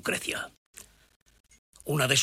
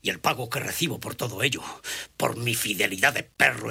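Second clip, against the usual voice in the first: usual speaking voice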